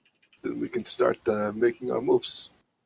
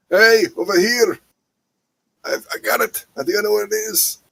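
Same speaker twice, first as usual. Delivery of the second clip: high, raised call to companions; yelling out; 10 semitones higher, 6 dB louder, faster than usual. Acted. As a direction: shout them over, excited at the find. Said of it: "yell out".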